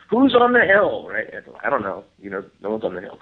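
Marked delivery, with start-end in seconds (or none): 0.1-1.1 high, loud call up into the dark; imitating a call. Matt calling up the hill at night, high loud call. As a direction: shout it toward the hill to find who's there.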